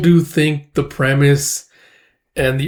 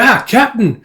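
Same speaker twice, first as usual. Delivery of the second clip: a startled shout, much higher and louder; alarmed cry; 10 semitones higher, 5 dB louder than usual. Acted. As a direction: cry out to the captain in shock. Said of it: "It's like".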